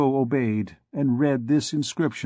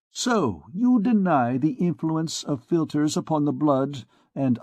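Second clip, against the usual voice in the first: a mocking, higher physician's voice; sneering physician; 4 semitones higher than usual. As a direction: scoff at the priest, pitched up. Voicing Coictier, mocking.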